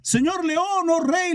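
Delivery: high-pitched donkey, no added volume; donkey crying out. Acted. Donkey appealing to the lion, high mock shouting. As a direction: call out high to the king. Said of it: "Y el burro le gritaba".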